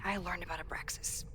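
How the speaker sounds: whisper. Acted. Whispered secret offer.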